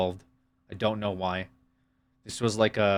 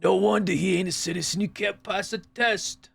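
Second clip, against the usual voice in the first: higher put-on sneering voice; mock sneering voice; 8 semitones higher than usual. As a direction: read the insult like a smug heckler. Reading mocking chat message.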